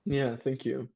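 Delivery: normal talking voice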